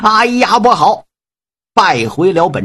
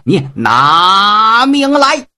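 slow, drawn-out higher challenge shout; battle cry; 4 semitones higher, much slower than usual. Acted. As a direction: stretch it out like a warrior's challenge.

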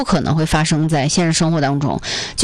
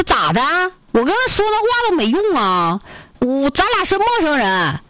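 put-on higher, scolding Northeast accent; 8 semitones higher than usual. Northeast brother scolds the student for taking the apple, in Northeast accent. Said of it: "操着一口这个东北口音的大哥".

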